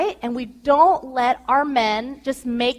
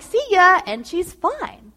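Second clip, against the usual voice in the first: higher, slow, breezy see-ya; breezy goodbye; 6 semitones higher, 3 dB louder, slower than usual. Retold or imitated. Husband quoting his easy drop-off at nursery, breezy. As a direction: toss off a casual goodbye.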